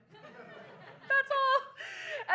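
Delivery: high, cracking mock sob; mock crying. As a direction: fake tears of joy, hammed up. Mock tearful wish fulfilled.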